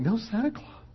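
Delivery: put-on high small voice of a boy near tears; a touch of a tearful child; crying little boy. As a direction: talk himself into a brave plan, still upset. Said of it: "and he's crying".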